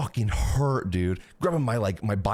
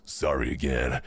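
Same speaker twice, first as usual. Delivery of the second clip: deep apology; 13 semitones lower than usual. Retold or imitated. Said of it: "He goes".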